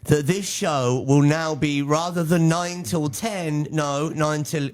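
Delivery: high, slow excited announcer; hyped announcement. Real, not acted. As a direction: build up the news, slowly.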